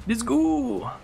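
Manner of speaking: high, drawn-out go; rallying cry. Pretend. Player sets off again, high rallying whoop. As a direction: whoop it to launch the voyage. Rallies himself to set off.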